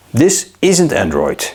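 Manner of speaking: ordinary delivery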